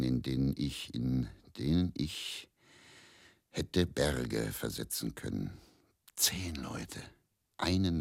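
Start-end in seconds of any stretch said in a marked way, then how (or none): 6.2-7.0 soft, awed whisper. Acted. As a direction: hush it as if it were a secret. Recalling a miracle night. (ehrfürchtig geflüstert)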